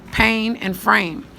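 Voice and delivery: "Pain" and "frame" drawn out slowly, each word leaned on; slow emphasis on rhyme words. Shown as a demonstration. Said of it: "These two right here are my rhyme".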